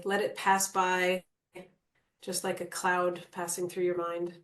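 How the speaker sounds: calm meditation guide with long pauses; guided meditation. Acted. Guide settles the group into natural breathing, calm meditation voice. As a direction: ease them into stillness, leaving space between phrases.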